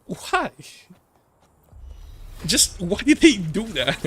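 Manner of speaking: squeaky stunned Why; incredulous why. Real, not acted. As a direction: throw it up in disbelief. Ranting at the localization.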